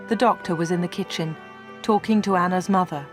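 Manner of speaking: ordinary delivery, nothing marked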